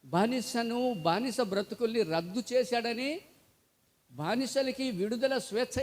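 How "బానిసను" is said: usual speaking voice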